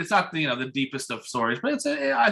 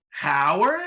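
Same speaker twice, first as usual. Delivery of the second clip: higher, rising, questioning "Howard"; puzzled wife; 4 semitones higher, 3 dB louder than usual. Retold or imitated. Retelling wife seeing husband unmasked, questioning surprise on "Howard". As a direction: imitate a wife's disbelief, "Howard" lifting at the end.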